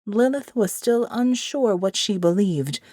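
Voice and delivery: plain reading voice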